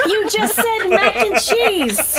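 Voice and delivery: high-pitched laughing protest. Real, not acted. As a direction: squeal it through laughter. Teasing friend, laughing outburst.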